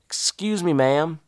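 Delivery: put-on polite Southern accent. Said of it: "using my own homegrown Southern accent".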